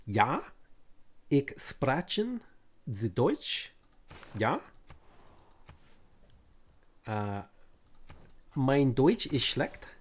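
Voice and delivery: slow, halting German with pauses. Shown as a demonstration. Streamer trying German for chat, halting German accent. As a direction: learner feeling for German words, slowly. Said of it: "try to speak a little bit of German".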